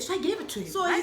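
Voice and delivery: ordinary talk, nothing marked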